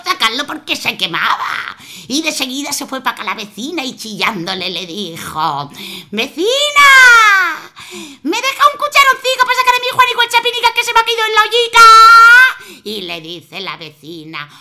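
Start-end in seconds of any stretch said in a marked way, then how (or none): 6.1-12.8 high, loud, fast pleading shout; mother shrieking to the neighbour for a ladle; calling out. Acted. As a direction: holler to the neighbour for help.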